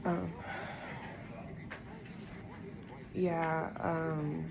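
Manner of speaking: quiet, holding back tears; emotional, subdued. Real, not acted. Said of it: "I'm getting emotional again".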